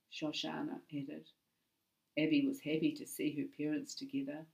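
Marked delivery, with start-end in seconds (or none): none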